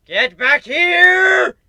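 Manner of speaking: high, loud, slow drawn-out shout; angry yell. Acted. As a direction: holler after her as she flees. Chasing the fleeing princess.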